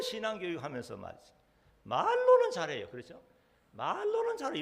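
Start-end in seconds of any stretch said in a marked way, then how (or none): 1.8-2.9 high, loud sarcastic jab; mock their talk, high and loud; mocking emphasis. Real, not acted. Preacher mocks lip-service faith. 3.7-4.6 high, loud sarcastic jab; mock their talk, high and loud; mocking emphasis. Real, not acted. Preacher mocks lip-service faith.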